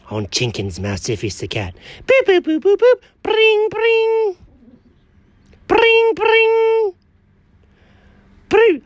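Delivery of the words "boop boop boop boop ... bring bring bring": high mouth beeps of keys pressed; phone dial beeps. Acted. Cat dialing Alfred, high keypad boops.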